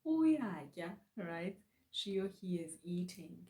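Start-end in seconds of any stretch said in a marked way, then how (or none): 0.1-1.0 Uyatya raised high and louder on the u. Shown as a demonstration. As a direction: chin up, hit the high u in Uyatya. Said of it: "raise your chin ... high pitch".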